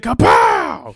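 a sharp, breathy high "Kapow!" like a cartoon hit; mock impact noise. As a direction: spit out a comic-book punch sound. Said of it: "She's like".

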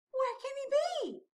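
high, forlorn little penguin; mildly whimpered storybook worry; plaintive sigh. A narrator's reading. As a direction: ask it in a small high whine. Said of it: "sighed Flip".

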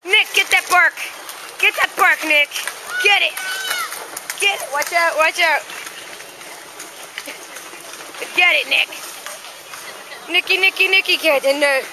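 high, loud eager calls; urging the dog. Real, not acted. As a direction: egg the dog on loudly.

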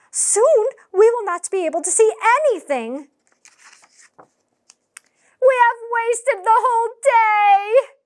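high, loud angry yelling. Acted. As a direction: shout your complaint at a friend. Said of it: "kept getting yelled at".

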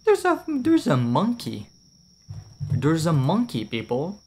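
high, loud burst of delight at the monkey; squealing monkey alert; excited shout. Real, not acted. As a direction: yell it out like you just spotted something amazing. Spots a monkey in game.

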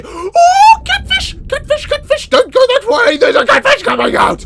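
high, loud panicked warning; panicked yelling. Real, not acted. Gamer yelling in panic as a catfish appears. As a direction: shriek the warning to the school of fish.

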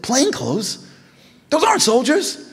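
high, loud disbelief; incredulous. Retold or imitated. Rejecting plain-clothes commandos with high incredulous disbelief. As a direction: scoff at the idea.

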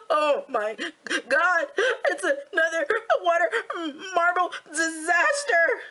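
high, drawn-out fake sobbing; mock crying. Vlogger imitates herself crying over water marbling, mock sobbing. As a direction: wail it in hammed-up tears, half laughing. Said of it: "doing this and crying and being like".

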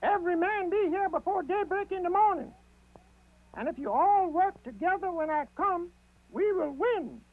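high rabbit voice, rallying; rally the troops as the rabbit; Brer Rabbit out loud. A narrator's reading. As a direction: Brer Rabbit calling the animals to gather. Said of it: "he said out loud".

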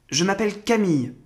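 put-on high, quick boy voice; light, high child's reply; young boy. A narrator's reading. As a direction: answer promptly as a little boy. Boy answers the oak.

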